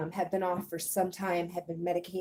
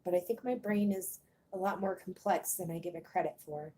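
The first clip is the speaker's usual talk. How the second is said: ordinary talk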